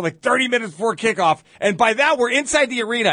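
ordinary delivery

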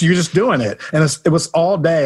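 ordinary talk

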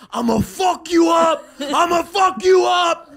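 high, slow, shouted imitation of a maniac; full-volume mock menace; threatening stranger. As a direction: roar the threat, stretching each word. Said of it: "Like that".

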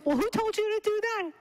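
high-pitched retold challenge; mock-startled questioning; imitated incredulity. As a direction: demand it in a high, put-on voice.

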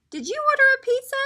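high, fast, loud question; surprised farmer's wife. A narrator's reading. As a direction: ask quickly in a high voice.

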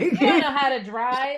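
high, loud heckle through laughter; laughing mock taunt. Host plays along as backseat driver, laughing mock taunt. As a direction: heckle loudly, cracking up.

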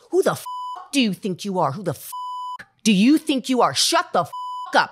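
loud, high-pitched angry outburst; yelled, incredulous rebuke with hard-hit words; retold yelling. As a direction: act out a furious telling-off at full voice. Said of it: "He yelled this".

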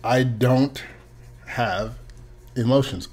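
soft, word by word, with pauses; hushed and halting, picking out each word; careful rereading. Real, not acted. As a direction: read it slowly, "I don't... have... emotions". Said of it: "read it again. Try again".

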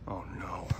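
low, very soft muttered worry; inner dread. Acted. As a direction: murmur it to yourself in dismay. Dreads missing again.

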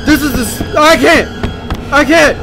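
high-pitched frantic yelling; scared, exasperated high shouting; panicked. Real, not acted. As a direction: cry out as if being chased. Still being chased in game.

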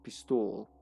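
"Pistol" in a mock foreign accent, low and soft; put-on accent. Gamer repeating the word pistol to himself, put-on accent. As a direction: softly savour "Pistol" with an affected accent. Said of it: "gave it that accent".

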